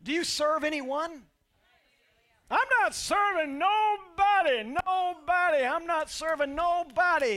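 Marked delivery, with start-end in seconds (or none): 2.5-7.3 loud, high stubborn refusal, slowed; selfish refuser. Retold or imitated. Pastor voicing someone who refuses to serve anybody, loud and stubborn.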